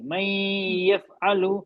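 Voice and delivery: मई यफ़अलु very slow, sound held in the nose; nasal ghunna recitation. Shown as a demonstration. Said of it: "लेकिन आवाज उसकी नाक की रहेगी".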